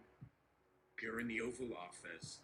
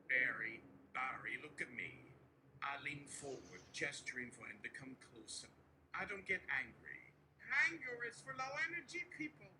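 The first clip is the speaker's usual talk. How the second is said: Donald Trump impression. Trump to nervous aide Barry, boastful and coaxing. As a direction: reassure Barry like Trump spinning a win.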